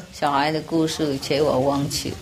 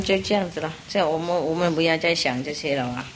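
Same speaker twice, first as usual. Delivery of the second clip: usual speaking voice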